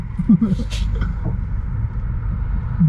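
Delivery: breathy laugh. Real, not acted. Laughing at own impression.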